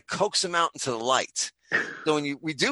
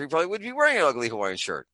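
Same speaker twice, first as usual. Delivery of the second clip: ordinary talk, nothing marked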